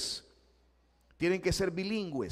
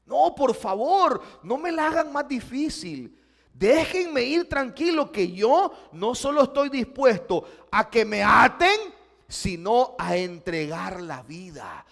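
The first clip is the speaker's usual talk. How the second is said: loud, high, resolute plea; Pablo's firm resolve; 5 semitones higher, 8 dB louder than usual. Acted. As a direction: insist you are ready to give your life. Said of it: "pero él dice".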